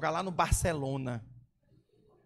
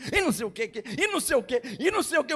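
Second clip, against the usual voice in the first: nagging mothers; 5 semitones higher, 5 dB louder, much faster than usual. Retold or imitated. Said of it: "fiquei cansado com essas mães aqui".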